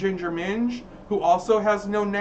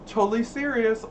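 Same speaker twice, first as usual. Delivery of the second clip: ordinary talk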